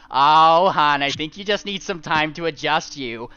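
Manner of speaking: wife soothing her fuming husband; smug sweet wife. Acted. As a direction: purr it condescendingly.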